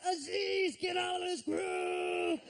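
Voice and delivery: high, drawn-out mock yell; hammed-up shouted order, stretched at the end; all-caps shout. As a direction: holler it like a furious typed message. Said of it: "He writes in all caps".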